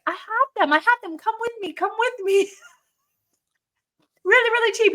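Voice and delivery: high, coaxing seller voice; pushy street seller. Retold or imitated. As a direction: lure her in like a hustler. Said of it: "this guy is like".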